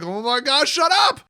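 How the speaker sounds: loud, high mock exasperated yell; annoyed bar patrons. Fed-up patron at the bar, hammed-up high loud groan. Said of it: "silently being like".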